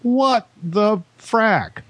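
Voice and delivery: incredulous outburst. Real, not acted. Outraged at drone guidelines.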